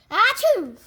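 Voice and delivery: big explosive achoo; loud sneeze. Acted. Gerald sneezing on his friend Piggy, loud achoo. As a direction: burst out in a huge sneeze.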